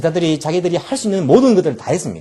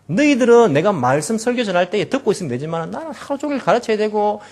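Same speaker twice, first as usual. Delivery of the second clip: higher, lightly voiced irritated complaint; mock grumpy Jesus; 6 semitones higher than usual. Imagined irritated Jesus to disciples, mock grumbling. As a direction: play a tired, annoyed teacher. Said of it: "이렇게 야단하시지 아니하셨습니다".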